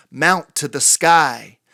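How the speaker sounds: usual speaking voice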